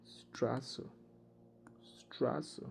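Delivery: soft whispered example; whispered word demo. Shown as a demonstration. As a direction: whisper स्ट्रास, unvoiced and hissed. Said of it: "एक word है स्ट्रास".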